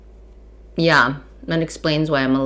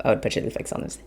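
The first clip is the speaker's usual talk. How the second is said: whisper; 7 semitones lower, 13 dB quieter, much faster than usual. Real, not acted. Confiding aside to viewers.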